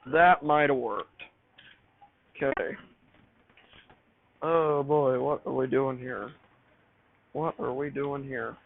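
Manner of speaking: ordinary talk, nothing marked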